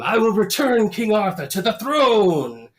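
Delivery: high, loud mock-epic proclamation; heroic vow. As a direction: declaim it like a hammy swashbuckler. Acting out the movie hero's vow.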